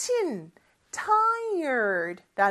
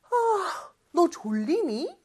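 mock sleepy question; 6 semitones higher than usual. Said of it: "이렇게도 물어볼 수 있겠죠".

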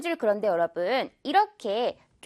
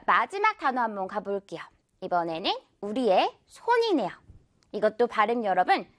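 ordinary talk, nothing marked

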